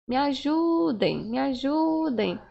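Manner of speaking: drawn-out, repeated demo plea for help; exalted plea. Shown as a demonstration. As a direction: beg for help, stretching the stressed vowel. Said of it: "que está exaltando".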